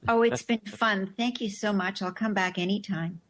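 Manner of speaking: ordinary talk, nothing marked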